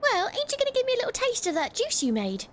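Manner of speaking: high, bright voiced village woman; chirpy, friendly tavern gal; cheerful barmaid voice.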